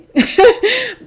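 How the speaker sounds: high bursting laugh; loud laugh. Real, not acted. Laugh after reply.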